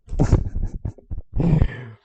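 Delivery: loud wheezing laugh. Real, not acted. Teacher amused by own crazy practice, real breathy laughter. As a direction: crack up at your own silliness.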